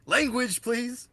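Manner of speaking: high-pitched prim reproach; mock scolding. Teasing a friend about swearing, mock scolding in a high voice.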